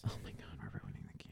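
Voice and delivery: rushed, breathless whisper; fast whisper. Real, not acted. Whispering to friends that the game is ruined. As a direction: hiss it out in mock panic.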